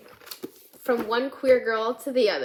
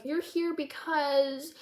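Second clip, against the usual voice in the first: nervous counselor; 5 semitones higher, 4 dB quieter, much slower than usual. Retold or imitated. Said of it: "he's also really nervous".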